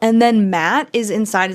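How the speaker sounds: normal talking voice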